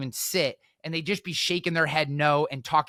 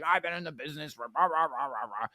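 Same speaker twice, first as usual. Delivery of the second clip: lightly voiced blustering old guy; mocking old-timer; 4 dB quieter than usual. Old heckler boasting of experience to the speaker, mock bluster. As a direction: imitate an old-timer's tirade trailing into nonsense.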